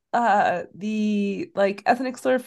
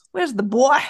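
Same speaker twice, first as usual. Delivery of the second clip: put-on raised, louder playful call on "boy"; playful dog calling; 6 dB louder, slower than usual. Owner walking round the house after the male dog, playful calling. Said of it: "and I'll be like".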